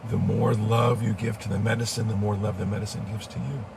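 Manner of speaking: ordinary talk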